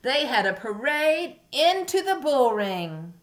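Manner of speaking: expressive picture-book narration; reading to a toddler. A narrator's reading. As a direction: tell it like storytime. Reading story to young children.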